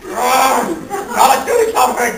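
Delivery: loud, strained, drawn-out outburst; mock bursting frustration. Superman breaking a stapler behind his back, bursting frustration. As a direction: let the held-in fury burst comically.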